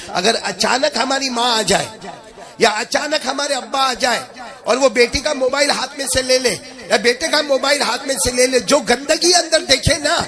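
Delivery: high, rising fervent warning; preaching at full force. Acted. Preacher warning youth about phones, rising fervour.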